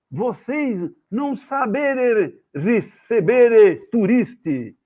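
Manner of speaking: slow, high, loud mock-Italian protest; shouted broken Italian. Tourist protests while led out of museum, hammed-up Italian accent. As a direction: declaim indignantly in fake Italian, stretching each word. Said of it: "eu tava gritando ali".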